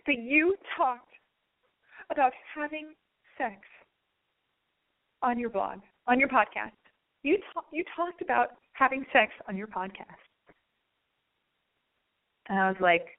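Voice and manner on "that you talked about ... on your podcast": high, halting mock confession; imitating a distressed man, pausing between phrases; reluctant confession. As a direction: drag it out in a pained, high imitation. Retold man finally confessing the secret.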